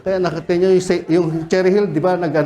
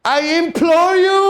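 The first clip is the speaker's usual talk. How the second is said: pleading demon; 10 semitones higher, 4 dB louder, slower than usual. Acted. Demon begging Jesus, acted.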